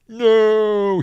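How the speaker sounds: mock high cry. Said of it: "she says".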